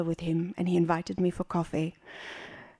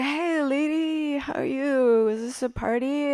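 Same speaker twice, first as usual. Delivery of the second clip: slow, higher-pitched stoner drawl; stoned Hollywood lizard; 6 semitones higher than usual. Acted. Stoned lizard greeting the woman with the broom, spaced-out drawl. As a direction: drag out the words like someone totally stoned. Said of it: "totally stoned".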